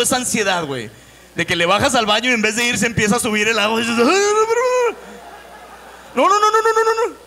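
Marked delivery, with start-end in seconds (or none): 4.0-7.2 high, loud panicky pleading at the rising water; panicked no. Retold or imitated. Comic at a French restaurant, panicking as the toilet overflows, high squealing no.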